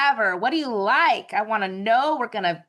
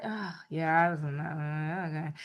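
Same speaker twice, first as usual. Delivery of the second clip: sheepish, quiet; 6 semitones lower, 8 dB quieter than usual. Retold or imitated. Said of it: "I'm like".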